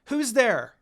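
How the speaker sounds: startled yell, who's there; yell back. Acted. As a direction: shout into the trees. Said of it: "began to yell back".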